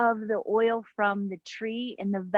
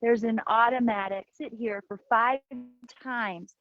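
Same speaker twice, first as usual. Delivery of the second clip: guided meditation. Acted. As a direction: guide them into the breath, unhurried. Leads breathing meditation over music.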